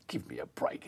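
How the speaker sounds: muttered under the breath; a whispered, exasperated aside. Real, not acted. Exasperated aside about outage.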